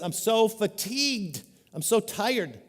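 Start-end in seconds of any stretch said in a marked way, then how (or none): 0.0-1.4 weary scripture reading. Acted. Reads Solomon's weary lament.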